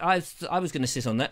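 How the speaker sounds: ordinary delivery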